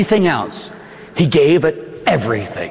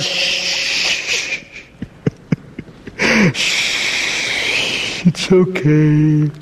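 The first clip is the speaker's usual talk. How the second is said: slow, grieving hush; wailing lullaby; much slower than usual. Acted. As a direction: soothe through sorrow, very slow. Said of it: "she wails".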